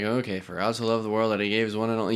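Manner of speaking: rushed, mumbled Bible verse; mumbled through. Shown as a demonstration. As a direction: rattle it off without care. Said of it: "you just mumbled through".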